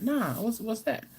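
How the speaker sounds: mock Chris, puzzled. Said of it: "He's like".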